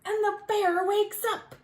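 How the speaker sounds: usual speaking voice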